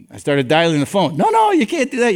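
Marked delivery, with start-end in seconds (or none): 1.2-2.1 high-pitched mock panic; panicked protest. Man stopping pastor from phoning his wife, panicked protest. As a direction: blurt out a pleading objection, pitched way up.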